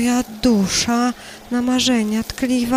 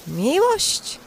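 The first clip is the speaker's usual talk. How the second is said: higher, softer, dreamy question; soft wondering rise; 7 semitones higher, 7 dB quieter than usual. Acted. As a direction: breathe it in wonder. Filon marzy o miłości. (wyższe, ciche, rozmarzone pytanie)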